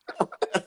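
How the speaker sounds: airy wheezing chuckle; high breathless laugh. Real, not acted. Laughing at his own old-man joke, airy laugh. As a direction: let a silent high laugh slip out.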